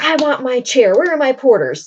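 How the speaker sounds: mock angry demand. De Guiche calling for his porters, acted anger. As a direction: act out an indignant nobleman demanding service. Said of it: "De Guiche gets really angry".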